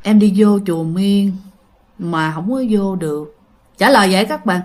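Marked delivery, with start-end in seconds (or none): 0.0-3.4 low, eerie, sinking voice; low deep voice. Retold or imitated. Ghost girl answers the driver in a low deep voice. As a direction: let each word drop deep and cold. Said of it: "cổ trả lời một cái giọng trầm trầm".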